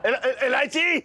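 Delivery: high-pitched stammered cry of a name; stammer, then call out in shock; high, alarmed call; startled calling out. Acted. Startled, stammers and calls out her name.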